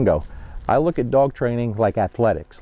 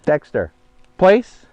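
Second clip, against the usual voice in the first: sharp, loud and high "place"; firm command; 3 semitones higher, 5 dB louder, slower than usual. Real, not acted. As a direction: snap the command out, louder. Repeats command to hesitant dog.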